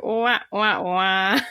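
imitated failed-joke trombone; mock comedy sting for a dud punchline; wah-wah-wah, like a losing horn; sad-trombone wah-wah.

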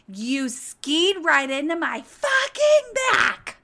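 loud, high, slow furious yell of a diva; diva meltdown yell. Retold or imitated. Gwen yelling at the skier who crashed into her, furious and loud. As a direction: lose it completely on the man who hit you. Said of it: "Gwen losing her fucking shit and having a diva moment".

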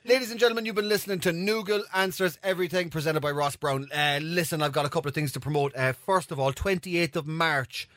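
show outro. Acted. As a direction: wrap up the show like a host plugging gigs.

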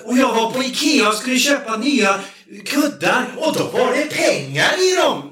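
high, excited character rant; shouted high-pitched impression, building to a yell; imitated woman's voice. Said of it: "det var typ så".

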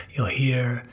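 plain reading voice

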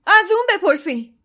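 high, loud cry; urgent cry. A narrator's reading. Ron pointing at the portrait, urgent loud cry. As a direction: point and shout.

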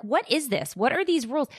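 retelling an outraged question to shelter staff; exasperated disbelief. Retold or imitated. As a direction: throw up your hands at absurd rules.

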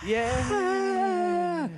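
long, very high sustained cheer; drawn-out high-pitched "yeah"; crowd cheer. Pretend. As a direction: play a far-off cheering crowd.

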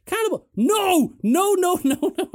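high, loud, panicked refusals; mock horror. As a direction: ham up the friend's dread. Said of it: "He goes".

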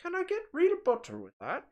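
very high put-on Irish; Irish accent. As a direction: order food in a mock Irish accent. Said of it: "that's a, not a, quite as sharp".

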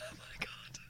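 breathless whisper. Real, not acted. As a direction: whisper it in disbelief. Stunned whisper.

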